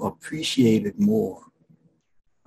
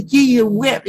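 put-on high, bright sing-song child reciting; childlike excitement; 12 semitones higher, 4 dB louder than usual. Preacher imitating children reciting the verse, high and thrilled.

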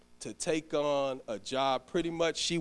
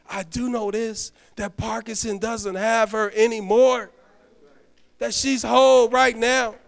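higher and louder, rising triumph; preaching at full force; 8 semitones higher, 9 dB louder than usual. Acted. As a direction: lift the church with certainty.

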